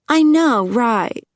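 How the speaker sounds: high, loud gushing agreement; high ditzy blonde. Acted. Juicy agreeing with Lindsay, high ditzy squeal.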